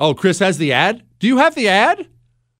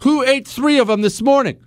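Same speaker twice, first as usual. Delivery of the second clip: a hint of the wife, pitched up; lightly voiced wife quizzing her husband; wife's voice; 9 semitones higher than usual. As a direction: play the wife, lightly raised and mock-annoyed. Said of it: "the wife comes home and she says".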